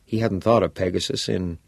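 usual speaking voice

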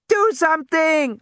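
put-on high, loud panicked girl's scream. A narrator's reading. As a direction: scream it at Grant. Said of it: "Alexis screamed".

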